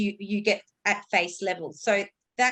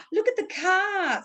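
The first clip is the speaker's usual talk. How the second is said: gasp, then high, slow "car" drawn out; high-pitched parent-to-child intonation, stretching "car"; excited emphasis on car; 9 semitones higher, slower than usual. Shown as a demonstration. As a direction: point out "car" with exaggerated singsong wonder. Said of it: "I'm emphasizing the word that I'm saying".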